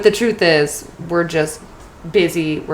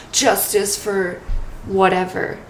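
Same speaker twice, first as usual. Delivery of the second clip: usual speaking voice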